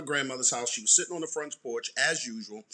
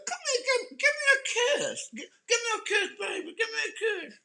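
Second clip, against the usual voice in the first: imitating grandma. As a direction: squeal hey baby like a doting granny. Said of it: "she'd be like".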